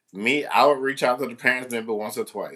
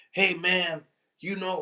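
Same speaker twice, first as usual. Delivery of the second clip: put-on higher, taunting teen voice; a hint of a student taunting the teacher; 8 semitones higher than usual. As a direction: lightly imitate a smug kid. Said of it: "he's like".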